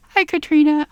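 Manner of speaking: high bright hello; excited greeting. Real, not acted. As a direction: wave hello eagerly. Greeting cousin on camera.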